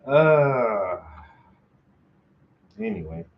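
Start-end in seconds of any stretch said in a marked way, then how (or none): none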